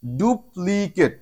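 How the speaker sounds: very slow, careful teacher's pronunciation; slow classroom model of the word; slow demo word. Shown as a demonstration. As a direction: draw the word out syllable by syllable. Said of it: "এরপরে আমাদের next word".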